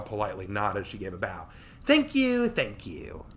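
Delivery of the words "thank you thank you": showy thanks. Acted. Tori bowing.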